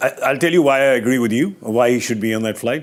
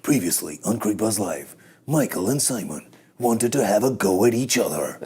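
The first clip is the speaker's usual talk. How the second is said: low, softer TV recap voiceover; mock-dramatic series recap; recap narrator; 3 semitones lower, 4 dB quieter than usual. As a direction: narrate a "previously on" teaser in a lower voice. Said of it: "I'll just narrate it myself".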